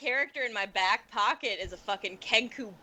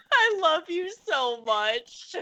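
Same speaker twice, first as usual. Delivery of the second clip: slow, tearful-laugh gushing; drawn-out words breaking into laughter; laughing hard; 3 semitones higher, slower than usual. Real, not acted. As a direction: gush through helpless laughter. Helpless laughter at joke.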